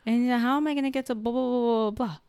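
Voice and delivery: high, droning mock voice; weird guy. Recounting a really weird man's rambling, high mock voice. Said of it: "He was kinda weird".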